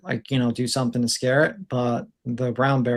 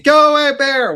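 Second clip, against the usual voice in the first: high, loud mock shout at a bear; yelling at bear; 12 semitones higher, 7 dB louder than usual. Hunters on each other's shoulders yelling at the bear, high loud shout. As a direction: holler to scare off a bear, much higher and louder. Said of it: "they started saying".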